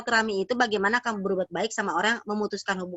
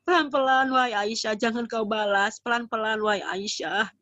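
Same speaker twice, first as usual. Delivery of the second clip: slow, gentle calming of an upset wife; 4 semitones higher, slower than usual. Acted. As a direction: soothe her, drawing the words out. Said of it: "Maka Nabi bilang gini kepada Aisyah".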